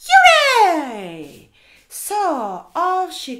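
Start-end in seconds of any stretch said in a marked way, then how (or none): 0.1-1.5 loud, high, drawn-out hooray; joyful cheer. Acted. Storyteller cheering that somebody is there, loud and excited.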